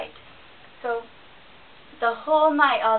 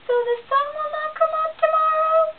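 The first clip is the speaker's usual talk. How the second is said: tiny squirrel; 7 dB louder than usual. A narrator's reading. Squirrel challenging the boastful bear, very high and small. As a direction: squeak up at the big bear.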